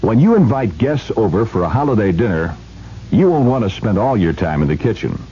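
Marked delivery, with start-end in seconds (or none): none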